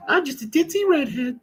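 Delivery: loud, squealing falsetto impression; hammed-up ditzy whine, sky-high; ditzy redhead. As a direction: play her as a wailing airhead. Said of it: "That's what she says".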